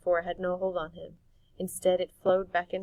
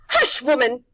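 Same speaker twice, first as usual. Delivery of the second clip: loud, higher crone snap; witch snapping; 6 semitones higher, 15 dB louder than usual. Acted. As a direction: silence her like a witch. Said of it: "Mar said".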